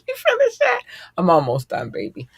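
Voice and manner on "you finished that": put-on very high child's voice, loud; imitating daughter. Mom imitating her daughter peeking into the room, high squeaky voice. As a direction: squeak it like a little girl peeking in. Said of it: "She just peeked in here like".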